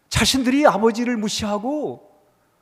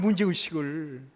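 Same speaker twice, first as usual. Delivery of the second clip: 문제의식을 repeated slower and quieter; soft echo; 7 semitones lower, 6 dB quieter than usual. Shown as a demonstration. Preacher echoing 문제의식을 to the congregation, slow and quiet. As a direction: let 문제의식을 sink in softly. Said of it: "문제의식을 갖는 거예요".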